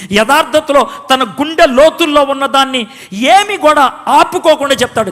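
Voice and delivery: usual speaking voice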